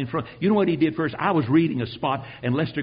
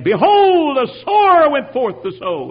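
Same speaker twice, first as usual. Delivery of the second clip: high, loud pulpit proclamation; the pastor thundering scripture from the pulpit; preacher's proclamation; 10 semitones higher, 9 dB louder, slower than usual. Retold or imitated. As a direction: boom it out like a revival preacher.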